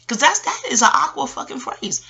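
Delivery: ordinary delivery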